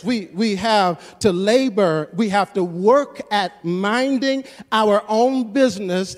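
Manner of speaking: loud, rising sermon delivery, pushing each phrase out to the church; full-force preaching. Acted. Pastor drives the point home to the church in a loud preaching voice. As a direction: preach it like the congregation needs waking up.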